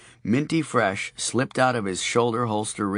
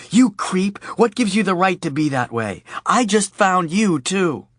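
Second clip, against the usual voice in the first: higher, louder indignant retort; angry Audrey; 10 semitones higher, 6 dB louder than usual. A narrator's reading. Audrey scolds Charlie for leaving her, in a loud angry voice. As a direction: snap at him, hurt and furious.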